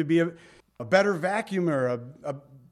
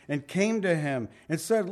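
normal talking voice

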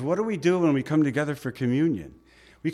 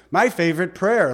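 ordinary talk

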